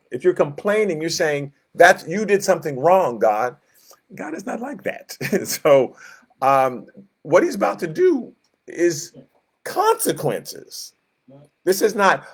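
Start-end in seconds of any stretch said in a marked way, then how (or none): none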